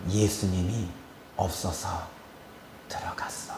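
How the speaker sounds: low, fading near-whisper; retold demon, very quiet and low; hushed spirit's reply. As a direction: answer darkly under your breath, with pauses. Voices the spirit's answer.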